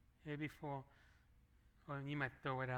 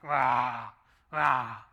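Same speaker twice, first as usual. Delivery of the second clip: a much louder, lower booming wow wow; loud stomach growl imitation; 4 semitones lower, 13 dB louder than usual. Preacher mimics a very hungry stomach, booming. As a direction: roar it like a starving stomach. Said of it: "when I'm really hungry, it's like".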